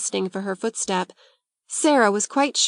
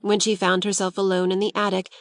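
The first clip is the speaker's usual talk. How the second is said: plain reading voice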